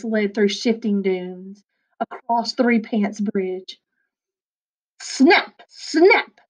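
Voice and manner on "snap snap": high, louder snapping jaws; snarling crocs. A narrator's reading. Hungry crocs under the bridge, snapping louder and higher. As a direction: bite each snap off like a croc. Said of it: "snarled the hungry crocs".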